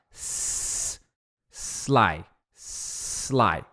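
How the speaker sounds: "sly" after a long held hiss; S drill. Shown as a demonstration. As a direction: stretch the S, then release into low, soft "sly". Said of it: "pra treinar você fala". ("sly" treinado com S prolongado)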